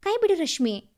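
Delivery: loud, sharp command to let go; angry order. Acted. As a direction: snap it at her in rage. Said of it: "ಅವನ ಕಣ್ಣು ಕೆಂಪಗಾಗಿತ್ತು".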